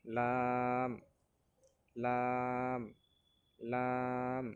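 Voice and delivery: লাম with a long, level vowel; recitation demo. Shown as a demonstration. Teacher models the letter for learners, drawn-out recitation. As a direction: hold each লাম on one steady note, pausing between. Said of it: "আমার সাথে সাথে পড়ার চেষ্টা করেন".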